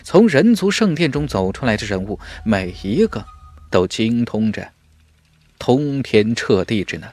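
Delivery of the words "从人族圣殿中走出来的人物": ordinary delivery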